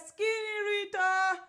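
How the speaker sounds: high, loud hail by name; imitated calling out. Retold man spots her and hails her, imitated call. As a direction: call across the room, mimicking him.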